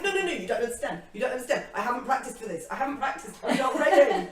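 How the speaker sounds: retold panic, giggling through it; mock panic. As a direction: beg them off, half laughing at your own panic. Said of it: "And I'm like".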